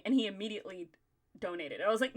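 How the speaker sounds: ordinary delivery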